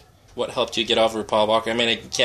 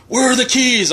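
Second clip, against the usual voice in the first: much higher, louder call from another room; distant yell; 12 semitones higher, 4 dB louder than usual. Retold or imitated. Bruce yelling for his keys from across the manor, loud distant call. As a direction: shout it from far down the hall. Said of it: "off in the distance".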